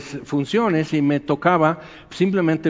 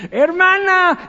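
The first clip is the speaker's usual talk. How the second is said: eager fan calling; 5 dB louder, slower than usual. Retold or imitated. Fans pushing to greet the singer, high adoring call.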